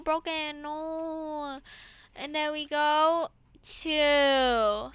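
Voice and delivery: ordinary delivery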